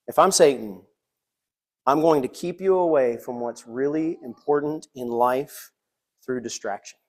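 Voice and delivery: ordinary delivery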